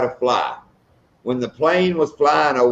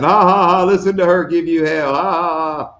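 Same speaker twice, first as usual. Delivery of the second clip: mock horse laughing; 4 semitones higher than usual. Teasing friend about getting yelled at, mock horse laughing. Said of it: "you were just horse laughing".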